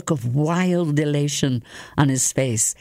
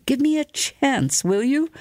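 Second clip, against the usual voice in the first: raised, pleading indignation; indignant protest; 12 semitones higher than usual. Acted. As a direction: protest hotly, voice up high. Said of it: "in a flash of indignation".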